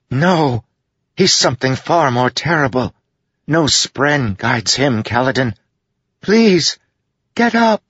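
high female spirit voice, building to an urgent plea; forceful, pleading. A narrator's reading. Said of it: "Syl said forcefully".

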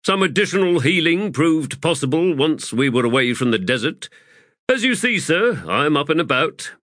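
lightly voiced, dry and cheeky; Pors, lightly voiced soldier. Pors answering his Fist, a lightly voiced wry soldier.

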